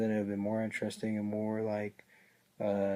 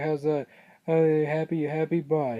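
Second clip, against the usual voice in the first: higher, louder mock taunt of Goldust; 5 semitones higher, 4 dB louder than usual. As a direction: toss it off like a smug wrestler rubbing it in. Goldust smashes food, taunting R-Truth.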